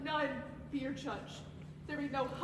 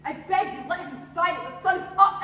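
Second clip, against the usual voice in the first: loud pleading; 10 dB louder than usual. Acted. Wife begs judge, voice rising.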